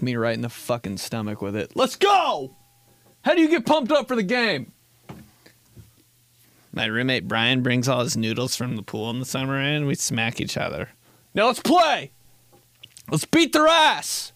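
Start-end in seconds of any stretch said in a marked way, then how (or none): 1.8-4.7 high hyped locker-room shout; pumped-up yell. Acted. 11.3-14.3 high hyped locker-room shout; pumped-up yell. Acted. Hyped team call.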